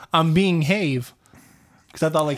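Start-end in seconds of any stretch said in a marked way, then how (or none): 0.1-1.1 slow "being have", HAVE rhyming with wave; misread "behave". Retold or imitated. Man recalling his old mistake, "have" said slowly as "hayv". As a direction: split it as "being HAYV", drawn out. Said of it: "used to go around being like".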